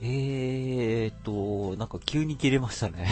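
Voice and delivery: usual speaking voice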